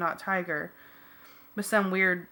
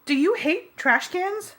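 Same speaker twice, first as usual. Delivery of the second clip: much higher, slow incredulous question; 11 semitones higher, 5 dB louder, slower than usual. A narrator's reading. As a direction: ask it in disbelief. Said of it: "Jake asked".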